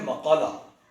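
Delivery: मकाला with a on the म: ma-kaa-la; correct pronunciation. Shown as a demonstration. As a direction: say मकाला as ma-kaa-la, zabar on मीम. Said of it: "मकाला ही इसका तलफ़्फ़ुज़ दुरुस्त है". (मकाला का दुरुस्त तलफ़्फ़ुज़, मीम पर ज़बर)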